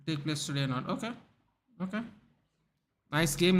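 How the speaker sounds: ordinary delivery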